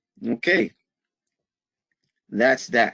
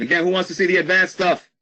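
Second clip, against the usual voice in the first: rallying call; 7 semitones higher, 4 dB louder, faster than usual. Real, not acted. Calling out to live viewers.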